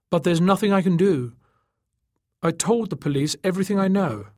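high, protesting helplessness; Tachyon, helpless. A narrator's reading. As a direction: protest that you are powerless. Tachyon's dialogue.

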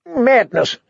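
shouting. A narrator's reading. Prisoner shouting at the guards, high desperate cry. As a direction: cry out in protest. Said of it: "pleading and shouting".

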